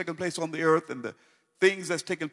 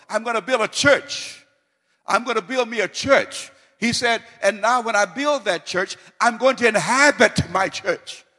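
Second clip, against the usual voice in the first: full-force preaching; 4 semitones higher, 5 dB louder than usual. Acted. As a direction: preach it loud, rising and ringing.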